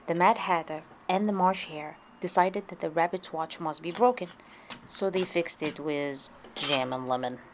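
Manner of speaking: usual speaking voice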